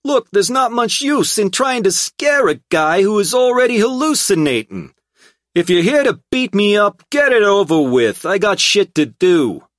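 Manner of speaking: high, loud brash thug; relieved brash hoodlum voice. A narrator's reading. Tripp character voice.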